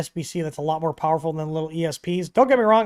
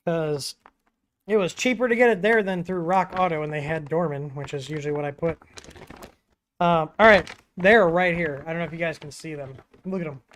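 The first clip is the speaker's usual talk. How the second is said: normal talking voice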